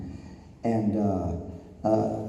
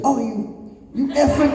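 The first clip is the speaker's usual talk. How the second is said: mock outrage; 14 semitones higher than usual. Acted English hostility to a countryman.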